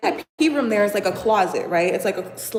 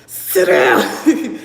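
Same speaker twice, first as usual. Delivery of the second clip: laughing command; 5 semitones higher, 7 dB louder than usual. Retold or imitated.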